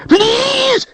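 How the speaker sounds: very high desperate howl; creature howl. A narrator's reading. Creature howling for mercy under Richard's knife. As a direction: wail it like a cornered creature. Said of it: "it howled".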